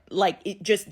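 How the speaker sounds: ordinary delivery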